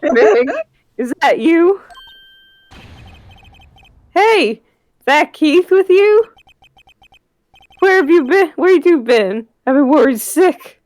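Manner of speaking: put-on high, slow old man voice; grumpy old man. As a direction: play a worried old grandpa. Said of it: "do you perhaps have an old man voice".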